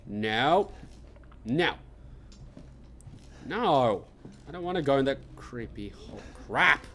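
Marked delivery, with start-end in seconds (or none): 0.1-4.0 panicked refusal. Real, not acted. Refusing the creepy hole.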